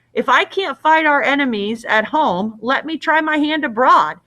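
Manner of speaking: put-on young hero pleading to go; crying out. A narrator's reading. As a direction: make an impassioned case. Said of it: "cried Theseus".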